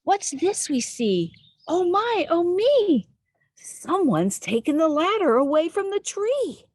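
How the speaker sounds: high, loud dismay; alarmed surprise. Acted. Tree dwellers discovering the ladder gone, high and alarmed. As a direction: react like the ladder just vanished.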